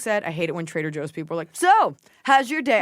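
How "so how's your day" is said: high, loud, slow mock-cheery greeting; hammed-up chipper store clerk; bubbly cashier voice. Mocking a cheery Trader Joe's cashier.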